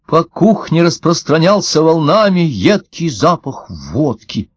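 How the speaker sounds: ordinary delivery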